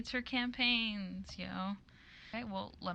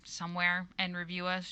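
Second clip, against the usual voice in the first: ordinary talk, nothing marked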